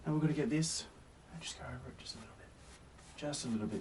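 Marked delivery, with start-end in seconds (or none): 1.3-2.5 quick whispered aside; fast whisper. Real, not acted. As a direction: rush it under the breath. Quick hushed instruction.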